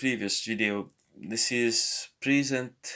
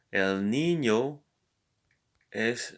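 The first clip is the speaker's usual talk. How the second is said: 1 slow model phrase; slower than usual. Shown as a demonstration. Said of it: "teacher models the phrase".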